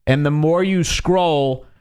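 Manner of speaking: ordinary delivery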